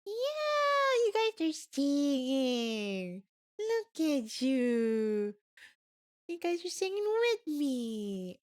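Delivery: put-on slow, high cooing baby talk; cutesy baby voice. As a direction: coo to the singing puppies. Cooing at the doodle dogs.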